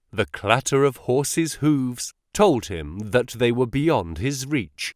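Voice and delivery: plain reading voice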